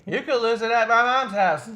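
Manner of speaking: loud, high joke announcement; hammed-up boastful challenge; mock challenge.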